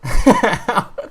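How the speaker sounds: high, loud belly laugh; burst of laughter. Real, not acted. Player cracks up over the Mario turtle card, laughing hard.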